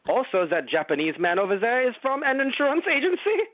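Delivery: rising, incredulous put-on voice; put-on agent voice. Acting out international insurance agents' exchange.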